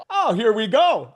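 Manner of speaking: loud, high, drawn-out anticipation; hammed-up thrill at expected praise; mock excitement. Storyteller expecting praise for his pants, hammed-up excitement.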